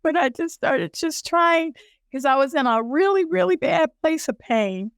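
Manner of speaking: high, unsteady voice holding back tears; tearful. Real, not acted. Woman recalling her prayer in pain, tearful. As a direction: push on through the tears. Composing herself after tearing up.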